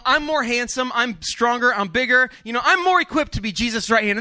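jealous disciple, huffy and loud; indignant disciple rant. Acted. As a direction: puff up and complain you deserve it.

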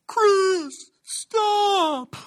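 high, drawn-out mock shout from afar; distant yell imitation. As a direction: call out high, as if far behind. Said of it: "I hear from the distance behind".

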